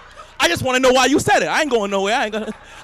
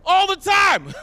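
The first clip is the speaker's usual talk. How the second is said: emphatic agreement; 7 semitones higher, slower than usual. Retold or imitated. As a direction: affirm it hard. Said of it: "I'm like".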